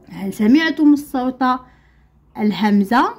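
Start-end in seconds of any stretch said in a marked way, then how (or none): none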